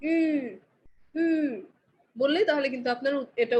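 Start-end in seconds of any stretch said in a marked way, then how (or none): 0.0-1.6 "উ" with lips pursed narrow like a fish; ü sound. Shown as a demonstration. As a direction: round tight fish lips and say "উ". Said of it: "মাছের মতো করে সরু করে বলবেন". (মাছের মতো সরু ঠোঁটে "উ")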